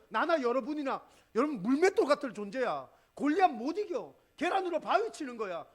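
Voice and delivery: 높고 크게 외치는 설교; 회중을 일으키듯 몰아붙여라; full-force preaching. Acted. (성도들을 격려하며 외치는 힘찬 설교)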